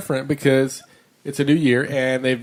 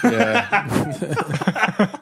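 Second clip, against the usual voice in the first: high laughing yeah; 8 semitones higher than usual. Real, not acted. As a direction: dissolve into laughter. Laughing at belly button joke.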